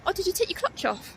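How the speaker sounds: high, alarmed inner question; sudden panicked thought. Retold or imitated.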